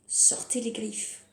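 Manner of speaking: normal talking voice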